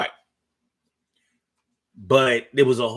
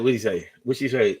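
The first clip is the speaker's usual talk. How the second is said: normal talking voice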